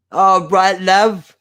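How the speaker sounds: high, loud, badly faked British accent; terrible accent. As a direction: call it out in a bad British accent. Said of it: "Terrible accent".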